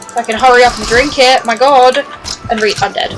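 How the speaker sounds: normal talking voice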